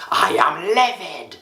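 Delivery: high, slow mock upset "I am livid"; hammed-up angry declaration; acting upset. Shown as a demonstration. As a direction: act out a charged complaint, drawn out. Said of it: "we use certain words like".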